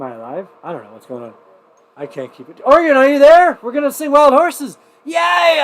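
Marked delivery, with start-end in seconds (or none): 2.6-5.6 much higher and louder, cheering the viewers; rally everyone for the toast with a whoop; excited calling out. Real, not acted. Host cheering his livestream viewers before the toast, excited and loud.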